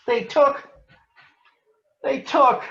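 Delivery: high, loud, choked with anger; too angry to speak. Acted. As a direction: sputter it out, furious. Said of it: "so angry she could hardly speak".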